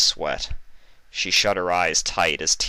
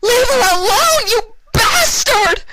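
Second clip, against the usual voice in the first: shrieking, much higher and louder; screaming; 11 dB louder, slower than usual. Acted. Vinyl screaming at the doctor hurting her friend. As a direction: scream it with a sob breaking through. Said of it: "Vinyl screamed".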